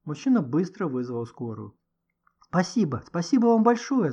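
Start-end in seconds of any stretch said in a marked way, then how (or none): 2.4-4.1 gushing, high and loud; flustered, effusive gratitude; babbled thanks. A narrator's reading. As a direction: pour out grateful thanks. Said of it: "залепетал Никита".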